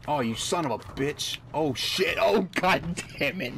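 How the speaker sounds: loud angry game rage; angry cursing. Real, not acted. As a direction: curse out loud in frustration. Raging at game death.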